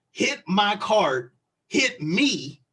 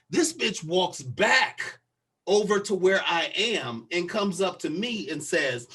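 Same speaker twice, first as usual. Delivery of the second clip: usual speaking voice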